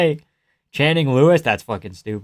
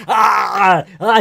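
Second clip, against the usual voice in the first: high, louder imitated cry of pain; mock pained yell; 6 semitones higher, 4 dB louder than usual. Viewer imitating fighter selling it, mock pained yell. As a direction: act out a fighter selling the hurt. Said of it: "He's going".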